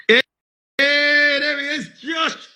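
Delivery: "there he is" lifted high in pitch; host spotting the next guest joining, cheerful and high; excited greeting. Real, not acted. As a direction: welcome him in brightly, pitch up.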